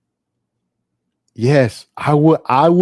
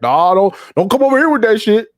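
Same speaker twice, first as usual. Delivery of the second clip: mock dismissal; 8 semitones higher than usual. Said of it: "it'd be like".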